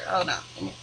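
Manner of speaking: unvoiced, far from mic; muttering off mic. Real, not acted. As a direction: mumble to yourself away from the mic.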